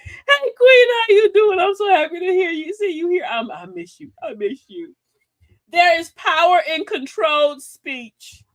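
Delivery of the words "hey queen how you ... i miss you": gushing fake greeting. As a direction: overdo the delight like a hostess faking love. Imitating the lady's gushing greeting.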